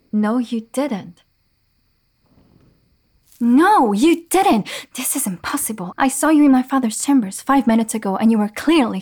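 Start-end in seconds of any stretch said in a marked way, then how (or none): 0.1-1.2 slow, deliberate no, you didn't; slow accusation. Acted. Repeating accusation, slower. 3.4-4.7 shouted, higher, emphatic didn't; loud accusation. Acted. Repeating accusation, now shouted.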